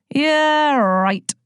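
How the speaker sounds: high scoffing yeah, then dropped; sarcastic sigh. Acted. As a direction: roll the eyes at the promise. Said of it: "sighed Chorus".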